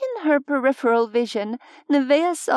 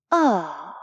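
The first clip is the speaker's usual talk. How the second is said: low, satisfied purr; 5 semitones lower than usual. A narrator's reading. Mab savours the attack she deflected, purring. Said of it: "her voice a purr".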